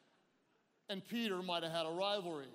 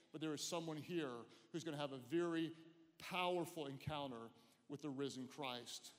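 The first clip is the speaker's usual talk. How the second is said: soft, low, intimate sermon voice; hushed preaching; 5 semitones lower, 5 dB quieter than usual. Acted. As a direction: draw the room in close and gentle.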